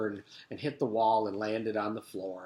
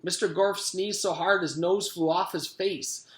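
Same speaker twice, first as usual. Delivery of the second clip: ordinary delivery, nothing marked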